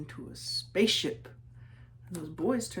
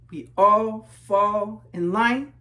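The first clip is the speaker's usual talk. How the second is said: slow, even, marching chant; slow refrain; 3 semitones higher, 10 dB louder, much slower than usual. A narrator's reading. Refrain of the marching class, slow chant. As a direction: drag it out in step like a marching line.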